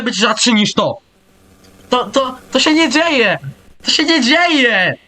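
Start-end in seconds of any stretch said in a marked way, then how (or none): 1.9-4.9 high, stretched-out disbelief; disbelieving cry. Real, not acted. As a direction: cry out it can't be happening. Disbelief at rare run.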